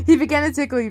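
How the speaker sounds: usual speaking voice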